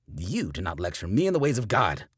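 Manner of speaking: loud stern rebuke; angry preacher. A narrator's reading. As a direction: put him in his place.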